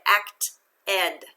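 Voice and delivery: "act ed" split into two slow parts; separate ed syllable. Shown as a demonstration. Teacher reads the word aloud, "act ed" in two syllables. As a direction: say "act ed" with the ending as its own full syllable. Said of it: "sound of the suffix will be ed".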